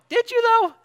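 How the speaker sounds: skeptical disciples. Retold or imitated. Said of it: "the disciples are sitting here going".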